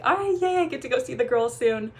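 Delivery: bright, high cheer that settles; replaying her happy yay before class; retold excitement, pitch jumping up on yay; excited cheer.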